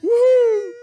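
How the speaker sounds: high loud whoop; excited cheer. Acted. Cheer during ad.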